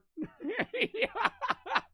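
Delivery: high-pitched giggle breaking out; high cackling laugh. Real, not acted. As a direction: crack up in a high squeal. Cracking up at the monster hunter.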